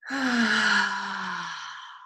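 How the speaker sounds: dramatic sigh. Shown as a demonstration. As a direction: demo a big releasing sigh. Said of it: "It'll sound something like this".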